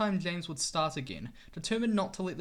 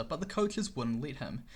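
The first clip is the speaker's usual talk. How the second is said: ordinary delivery, nothing marked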